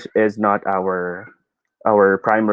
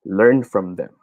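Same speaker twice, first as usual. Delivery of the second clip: usual speaking voice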